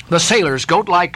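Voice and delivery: usual speaking voice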